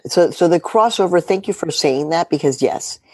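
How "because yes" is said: normal talking voice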